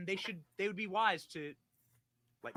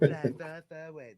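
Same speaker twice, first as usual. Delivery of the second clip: a light take on the politician's voice; Donald Trump; 7 dB louder than usual. Acted. Host starting to read the post, light politician impression. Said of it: "I'm gonna read this in Donald Trump voice".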